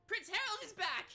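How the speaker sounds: very high, loud, ecstatic crowd cheers; cheering crowd. Retold or imitated. Townsfolk welcoming the returning prince, loud cheers. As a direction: scream it like an adoring fan. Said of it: "you can all hear".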